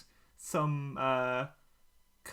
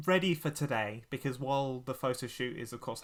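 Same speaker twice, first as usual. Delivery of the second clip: ordinary talk, nothing marked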